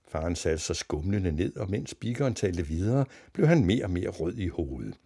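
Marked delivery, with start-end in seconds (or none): none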